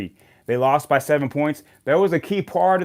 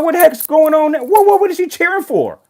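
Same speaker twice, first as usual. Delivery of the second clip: much higher, louder incredulous outburst; can't believe what he sees; disbelief; 13 semitones higher, 6 dB louder than usual. Retold or imitated. Fan seeing a Dodgers fan cheer a Red Sox homer, a high, loud disbelieving outburst. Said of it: "And I'm like".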